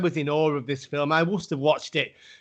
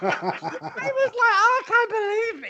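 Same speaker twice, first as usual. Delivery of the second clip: high, laughing imitation of weeping; mock teary joy; much slower than usual. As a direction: squeak it out half laughing, half sobbing. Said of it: "I was like".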